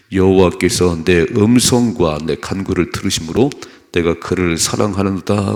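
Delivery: loud, declaimed Bible verse; firm, projected scripture read-out. Acted. Reads the Bible verse aloud.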